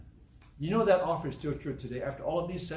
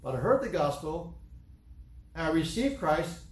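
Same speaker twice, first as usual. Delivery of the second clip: usual speaking voice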